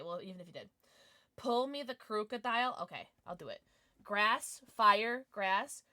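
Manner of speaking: ordinary delivery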